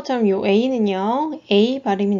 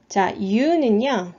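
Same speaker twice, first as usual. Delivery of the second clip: usual speaking voice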